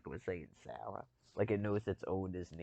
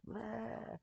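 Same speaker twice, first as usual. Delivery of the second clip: high silly "Bleh" sound; mock animal bleat; 4 dB quieter than usual. Voicing another species' reaction, high silly bleat. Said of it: "the other species just goes".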